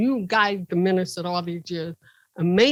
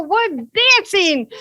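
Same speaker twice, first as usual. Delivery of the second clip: high, slow, carefree answer; high drawn-out chirpy reply; innocent reply; 11 semitones higher, 6 dB louder, slower than usual. Retold or imitated. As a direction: answer as if nothing is wrong.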